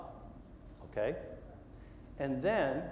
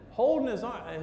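ordinary talk, nothing marked

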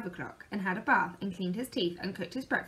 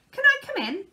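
high and loud bright greeting; cheery cry; 9 dB louder, faster than usual. A narrator's reading. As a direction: burst in with the greeting. Mister Busy barging in.

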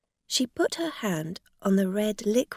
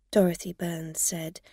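usual speaking voice

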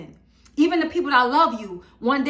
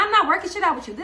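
higher, defiant retort; snapping back; 7 semitones higher, faster than usual. Retold or imitated. To her late ex, mock-defiant and higher-pitched. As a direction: fling it back at him. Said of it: "And I'm like".

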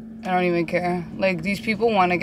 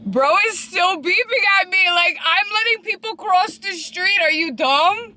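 exasperated rant; 10 semitones higher than usual. Real, not acted. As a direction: vent at the rude driver. Ranting at beeping driver.

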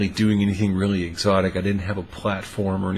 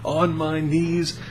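mock formal begging; 6 semitones higher than usual. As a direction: make it a grand ceremonial vow. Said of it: "she just begged".

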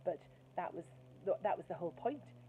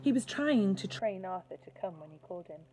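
usual speaking voice

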